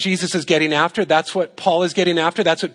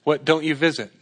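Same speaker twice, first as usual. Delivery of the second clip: ordinary delivery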